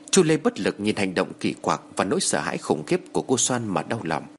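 usual speaking voice

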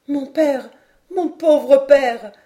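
high, loud, drawn-out lament; distraught cry. A narrator's reading. Mary Grant at lord Glenarvan's knees, crying out. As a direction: cry out slowly for a lost father. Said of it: "s'écria Mary Grant".

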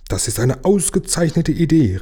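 high-pitched, delighted exclamation; calling out. A narrator's reading. Herr Ärmel applauds the lighthouse proposal, calling out high. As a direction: exclaim it with lifted enthusiasm. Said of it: "rief Herr Ärmel".

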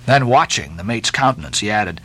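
ordinary delivery

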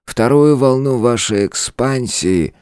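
slow, drawn-out words. A narrator's reading. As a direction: stretch each word slowly. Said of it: "протянул он".

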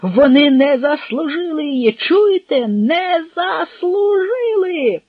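very high, loud outburst; frantic, screaming insistence; hysterical shout. A narrator's reading. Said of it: "— істерично викрикнув Потоцький".

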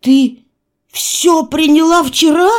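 high, loud, stammered horror; loud, high-pitched alarmed question; wild voice. A narrator's reading. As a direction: blurt it out wildly, aghast. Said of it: "спросил я диким голосом".

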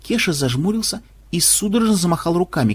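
plain reading voice

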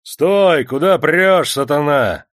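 loud, high guard's shout; angry challenge. A narrator's reading. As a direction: halt the intruder with force. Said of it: "раздались сильные и злые голоса".

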